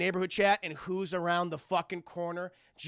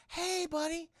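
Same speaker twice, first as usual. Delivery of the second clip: Joker; 8 semitones higher, slower than usual. Acted. Answering poor Charlie's apology, mockingly soothing. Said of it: "Joker's like".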